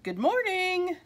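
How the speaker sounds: high, drawn-out sing-out greeting; cheerful greeting. A narrator's reading. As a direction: greet the pets brightly, pitched well up. Cheerful greeting to pets in story.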